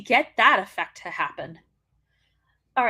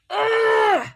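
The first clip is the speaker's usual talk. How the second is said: high loud ugh of revulsion; disgusted groan; 10 semitones higher, 5 dB louder than usual. Real, not acted. Begging the wrestler to drop the gimmick, a loud disgusted ugh.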